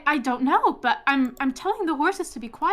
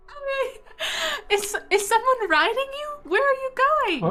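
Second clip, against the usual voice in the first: bewildered; 13 semitones higher than usual. Acted. Druid questions unseen rider.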